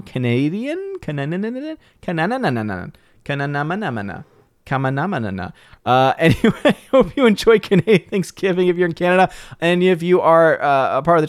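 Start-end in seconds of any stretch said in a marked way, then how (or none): 0.0-5.5 Canananana babbled in tumbling na-na syllables; playful mispronunciation. Shown as a demonstration. Host hunts for a wrong Canada, babbling Canananana with repeated na syllables. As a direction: garble Canada into softer Canananana nonsense, questioning at first. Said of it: "figure out how to say Canada wrong".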